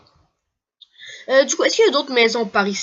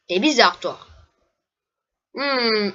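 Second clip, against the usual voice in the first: usual speaking voice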